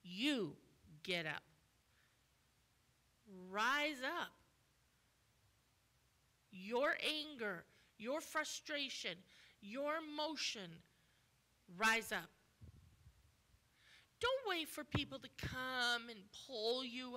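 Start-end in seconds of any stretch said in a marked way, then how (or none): 0.0-10.8 loud, forceful command to rise; Jesus commanding. Retold or imitated. Jesus orders the lame man to get up, loud and forceful. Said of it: "And he's like". 11.8-12.3 much louder, ringing "rise up"; loud command. Retold or imitated. Jesus calls the man to his feet, loud.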